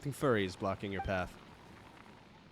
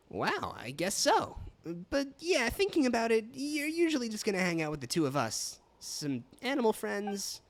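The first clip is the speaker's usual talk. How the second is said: high-pitched game companion; 10 semitones higher than usual. Acted.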